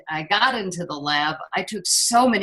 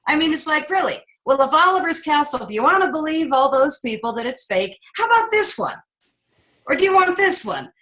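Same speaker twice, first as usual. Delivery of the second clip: really? how about this one; incredulous; 9 semitones higher than usual. Real, not acted. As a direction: pitch climbing high in disbelief. Incredulous challenge to the sceptics.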